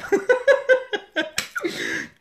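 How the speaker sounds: high, bright laughing fit; high burst of laughter. Real, not acted. Vlogger laughing at her own joke to viewers.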